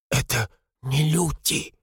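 slow, halting frightened voice; teeth-chattering fear. A narrator's reading. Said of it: "стуча зубами". (медленно, запинаясь от страха)

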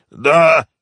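high, loud yes under duress; strained, forced out. A narrator's reading. Captive giving in under the gangster's heel, strained. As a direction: force it out in pain. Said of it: "выдавил артист".

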